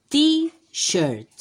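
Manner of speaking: t-shirt said high and bright, stretched for copying; emphatic model word. Shown as a demonstration. Teacher models t-shirt for children, high and emphatic. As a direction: sing out t-shirt so kids can copy. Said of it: "A ver cómo te sale".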